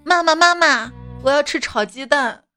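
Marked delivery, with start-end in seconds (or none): none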